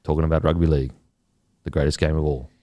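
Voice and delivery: low register, no squeezing; fully relaxed voice. Shown as a demonstration. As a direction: sink into a low, loose voice. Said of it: "fully relaxed".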